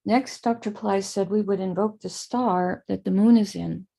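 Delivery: ordinary talk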